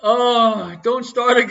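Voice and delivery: loud protest. Real, not acted. Laughing protest at wife.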